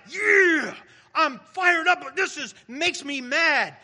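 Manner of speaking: hammed-up angry rant; mock fired-up anger. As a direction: play the thrill of getting mad. Acting out energized anger.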